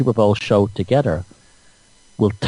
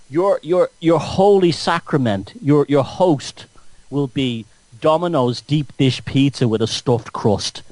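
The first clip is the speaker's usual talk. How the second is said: ordinary delivery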